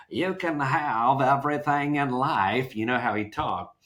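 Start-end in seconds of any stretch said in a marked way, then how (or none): 0.1-2.7 light imitation of a Southern motivational speaker; Southern accent. Imitating the Southern guy on the computer course, light Southern accent. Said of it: "this guy with a Southern accent".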